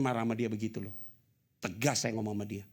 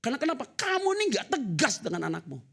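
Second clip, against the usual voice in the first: loud, high-pitched preaching; preaching at full force; 12 semitones higher, 6 dB louder than usual. Acted. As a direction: thunder it out to the congregation. Preaching rebuke continues. (khotbah berapi-api dengan suara keras dan tinggi)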